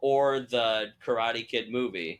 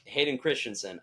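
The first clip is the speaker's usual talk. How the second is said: ordinary talk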